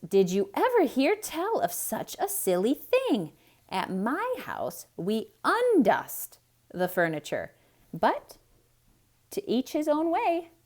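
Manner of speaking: high, loud incredulous maid; Amelia Bedelia voice. A narrator's reading. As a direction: scoff at the silly idea.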